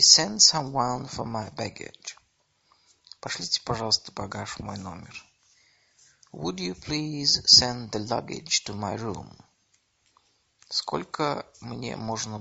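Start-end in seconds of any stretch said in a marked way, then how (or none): none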